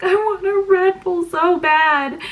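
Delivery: high, slow, whiny mock cry; mock whiny sobbing. Pregnant wife to husband, whiny mock sob over Red Bull. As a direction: drag it out like a sobbing craving.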